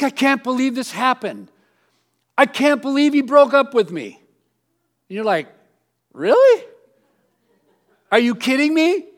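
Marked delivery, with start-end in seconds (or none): 0.0-4.2 higher, louder mock disbelief; shocked friend. 6.1-9.1 high, loud mock disbelief; incredulous. Incredulous reply.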